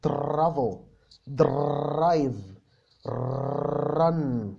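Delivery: travel, drive, run with a curled tongue R that touches nothing; teacher shows the American R to Egyptian learners; English R demo. Shown as a demonstration. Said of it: "ما تخليش لسانك يلمس حاجة في بقك".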